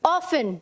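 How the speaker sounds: often said as OFF-un, no T sound; often pronounced without its T; often with silent T. Shown as a demonstration. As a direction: say often like "awfun", the T left silent. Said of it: "तो आपको कैसे बोलना है इसे".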